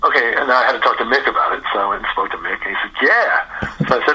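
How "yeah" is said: high, drawn-out yeah; a long, rising yeah of keen agreement; retold eager yes. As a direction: imitate a delighted, instant yes. Retold eager acceptance of offer.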